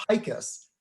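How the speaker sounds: Picus said as PIKE-us; anglicized pronunciation. Shown as a demonstration. Telling listeners how his Polish surname goes, "Picus" anglicized as PIKE-us. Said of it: "no idea how to pronounce that".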